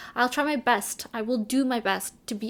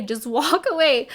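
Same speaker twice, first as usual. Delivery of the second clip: laughing quote; 3 semitones higher, 6 dB louder than usual. Retold or imitated. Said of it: "he would say".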